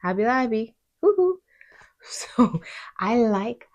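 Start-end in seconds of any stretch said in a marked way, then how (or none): none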